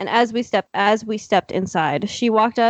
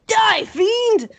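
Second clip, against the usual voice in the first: mock battle cry; 12 semitones higher than usual. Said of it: "I could hear him shouting".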